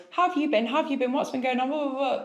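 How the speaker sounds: high, breezy imitation of coffee small talk; lightly played friend rattling off catch-up questions; mock chatty friend. Friend catching up over coffee, mock breezy chatter.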